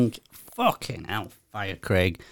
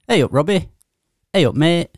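a hint of a mocking Yorkshire coworker, pitched higher; exaggerated Yorkshire greeting; 6 semitones higher than usual. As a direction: lightly overdo the Yorkshire "Hey up, mate" like teasing colleagues. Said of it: "they're really fucking accentuating it".

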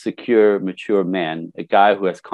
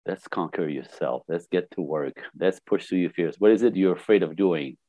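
quiet coaching; 4 semitones lower, 6 dB quieter than usual. Retold or imitated. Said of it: "I go".

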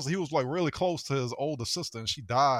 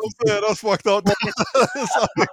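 nervous laugh; 5 semitones higher, 8 dB louder than usual. Real, not acted. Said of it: "nervous laugh".

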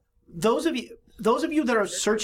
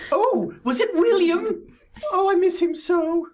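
put-on high, slow quavering old lady; grieving old lady; 10 semitones higher, 4 dB louder, slower than usual. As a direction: wail for a lost son. GM voices grieving Duchess.

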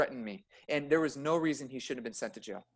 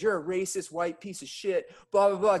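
higher-pitched mock rant, little added volume; raised-pitch retold abuse, trailing into blahs; retold screaming insults; 6 semitones higher than usual. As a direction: imitate an angry man's tirade, held back. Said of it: "screaming at me. He goes".

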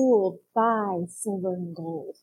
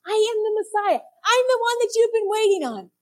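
proclaiming; 10 semitones higher, 6 dB louder than usual. Retold or imitated. Jesus declaring himself.

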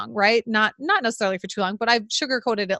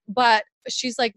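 normal talking voice

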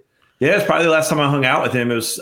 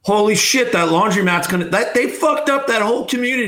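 shocked; 8 semitones higher than usual. Real, not acted. Reacting to the explosion footage.

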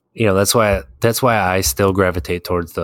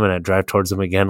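usual speaking voice

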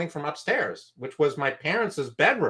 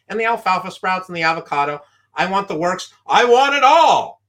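faster, then a loud high climax; louder and higher up to the last line; greedy excitement; 6 semitones higher, 9 dB louder than usual. Retold or imitated. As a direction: build to a loud demand for everything. Ordering everything, building excitement.

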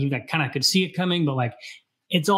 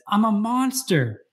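higher, slow, anguished self-loathing; anguished cry; 9 semitones higher, slower than usual. Retold or imitated. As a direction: wail it out slowly, voice pitched up high. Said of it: "he says".

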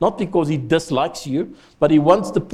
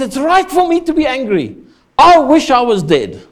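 put-on higher, louder sulky tantrum as a stamping toddler; toddler tantrum Jonah; 8 semitones higher, 5 dB louder than usual. Jonah sulking at God like a toddler in a tantrum. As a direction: throw a childish fit at God. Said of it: "like a toddler throwing a tantrum".

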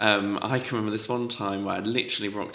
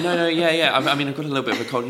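retold flustered excuse, higher and louder; flustered protest; 5 semitones higher, 6 dB louder, much faster than usual. Student excusing her voice to a vocal trainer, flustered.

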